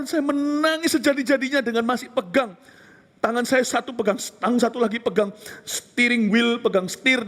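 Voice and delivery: usual speaking voice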